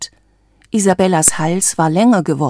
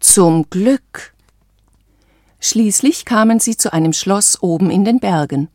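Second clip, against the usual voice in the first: ordinary delivery, nothing marked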